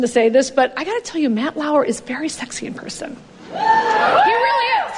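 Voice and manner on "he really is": swooning gush. Real, not acted. Gushing about Matt Lauer.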